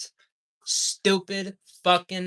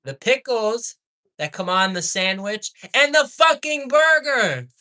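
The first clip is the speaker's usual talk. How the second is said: angry outburst; 5 semitones higher than usual. Real, not acted. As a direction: yell it, losing your temper at them. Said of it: "I apologize for the outburst".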